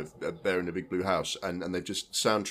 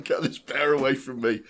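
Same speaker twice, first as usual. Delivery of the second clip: mock alarmed Malala; 6 semitones higher, 4 dB louder than usual. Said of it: "Malala's like".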